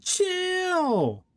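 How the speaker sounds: high drawn-out squeal; shrieking jackpot winner. Real, not acted. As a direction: stretch out chill in a high squeal. Reacting to very long patch notes.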